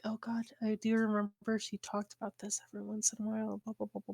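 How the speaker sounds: quick, whispery mumbling; muttered aside. Acted. Player acting out pensive muttering to himself, hushed mumble. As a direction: mumble it fast under your breath, fading out. Mutters worried recollection while circling her.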